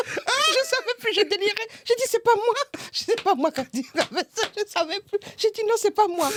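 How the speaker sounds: frantic retelling. Retold or imitated. Reliving panic at the nurse.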